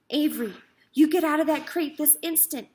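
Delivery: loud mother's order; stern command. A narrator's reading. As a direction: tell him off firmly. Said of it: "commanded his mother".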